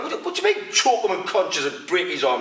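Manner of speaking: you can't believe what he just said; incredulous. Retold or imitated. Ian questioning the doorman's jiu-jitsu advice, high incredulous. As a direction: pitch jumps way up in disbelief.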